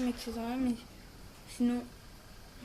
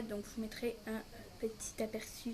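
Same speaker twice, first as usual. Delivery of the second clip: whispered aside; 2 semitones lower, 8 dB quieter than usual. Real, not acted. Quick aside to viewers.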